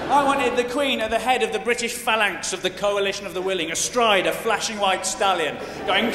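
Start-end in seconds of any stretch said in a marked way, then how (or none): none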